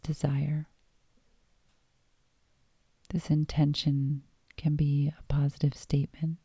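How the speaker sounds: calm meditation voice with long pauses; guided meditation. Acted. Host guiding listeners lying down into yoga nidra, guided meditation. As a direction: guide them gently inward, leaving space between phrases.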